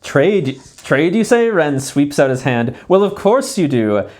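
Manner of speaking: showy merchant voice, high and loud; hammed-up traveling peddler; trader voice. Voicing the trader Ren.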